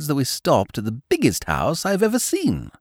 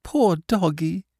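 higher and slower, a lightly voiced little boy; tearful small boy; 8 semitones higher, slower than usual. A narrator's reading. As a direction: repeat it slowly, sadly, like a small child. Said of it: "he said".

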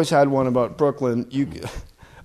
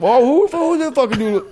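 much higher put-on squeaky dog voice; dog character; 10 semitones higher, 4 dB louder than usual. Dad playing the Pomeranian for his son, high indignant voice. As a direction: yap indignantly as the tiny dog. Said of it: "doing a character in the car".

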